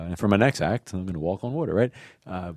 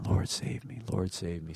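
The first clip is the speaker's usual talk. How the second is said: quiet prayer; 8 dB quieter than usual. Shown as a demonstration. Models repeated quiet prayer.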